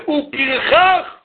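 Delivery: high, angry ranting father. Retold or imitated. Father rants to mother about the boy, high and heated. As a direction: let the father's exasperation pour out. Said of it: "הוא שומע את אבא אומר".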